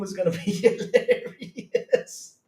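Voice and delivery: drawn-out word dissolving into laughter; real laughing fit. Real, not acted. Streamer joking about a Fruit of the Loom partnership, cracking up. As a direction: crack up halfway through the sentence. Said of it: "I'm still laughing".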